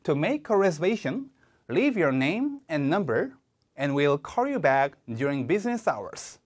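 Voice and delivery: "make" stressed, breaks before "and", very slow; slow exam-style model reading with phrase breaks. Shown as a demonstration. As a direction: lean on verbs and pause before "and". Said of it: "제가 읽어볼게요".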